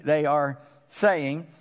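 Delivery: slow, each word weighed, they are saying; stress on saying. Shown as a demonstration. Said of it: "they're not really singing".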